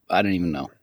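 normal talking voice

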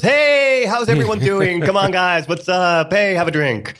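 bubbly life-of-the-party voice; outgoing party guy. Retold or imitated. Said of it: "the guy who walks into a party and goes".

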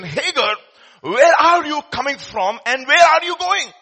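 the Lord confronting Hagar, booming and high; the Lord's call. Acted. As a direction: call her by name with divine force.

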